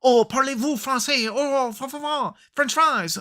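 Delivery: high-pitched cartoon French gibberish; hammed-up French babble; mock French. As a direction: put on a silly, high French accent. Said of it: "it's weird that everyone speaks English".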